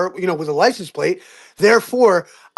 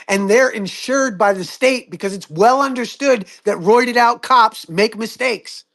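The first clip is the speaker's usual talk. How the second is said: ordinary talk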